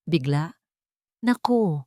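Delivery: ordinary delivery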